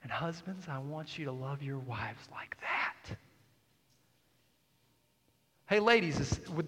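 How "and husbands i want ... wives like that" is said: softly whispered plea to husbands. Real, not acted. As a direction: lean in and whisper it. Pastor urging husbands intimately.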